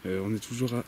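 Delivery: ordinary talk, nothing marked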